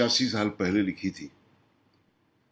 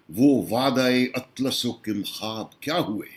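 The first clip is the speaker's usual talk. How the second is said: measured Urdu nazm recital, pitched above his talk; declaimed mushaira-style poetry; poem recitation; 3 semitones higher, 4 dB louder than usual. Acted. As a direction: recite it as verse, pausing after each line.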